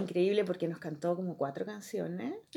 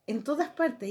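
ordinary talk

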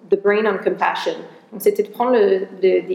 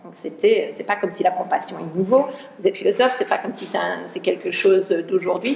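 ordinary delivery

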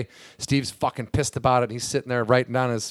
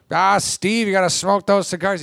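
higher, louder announcer voice; Harry Caray impression; 7 semitones higher, 4 dB louder than usual. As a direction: tease Steve like the old announcer. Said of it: "Harry starts".

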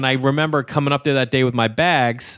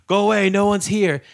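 higher-pitched Eastern European brush-off; Eastern European accent; 7 semitones higher than usual. Retold or imitated. Old man to stranger at his door, higher Eastern European accent. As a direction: send them away in a Slavic accent. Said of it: "if he's Russian or Yugoslavian".

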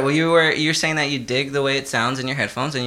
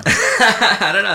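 laughing; 7 semitones higher, 5 dB louder, much slower than usual. Real, not acted. Laughing reply.

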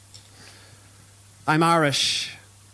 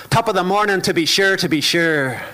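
put-on higher, louder paddywhack Irish accent; 7 semitones higher, 6 dB louder than usual. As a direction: ham up the touristy Irish accent. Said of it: "cause tourists to shout".

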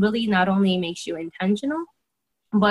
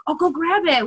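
eager urging; 9 semitones higher, 4 dB louder than usual. Retold or imitated. Urging client to fetch brochure.

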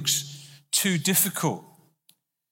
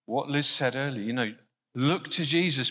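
ordinary talk, nothing marked

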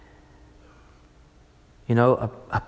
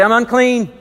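higher, louder announcement; leper's call; 6 semitones higher, 4 dB louder than usual. Acted. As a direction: call out your own shame. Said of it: "you have to say".

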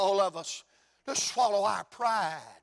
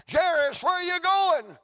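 calling bystander; 9 semitones higher, 4 dB louder than usual. Acted.

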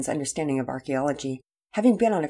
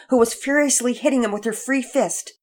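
ordinary delivery

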